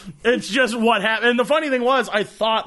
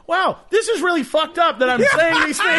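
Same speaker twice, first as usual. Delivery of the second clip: high, raised, laughing self-astonishment; mock startled realization; 9 semitones higher, 4 dB louder than usual. Man hears himself screaming at kids, startled realization. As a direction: catch yourself mid-rant, voice jumping up. Sudden realization mid-rant, acted.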